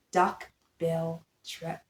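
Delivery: plain reading voice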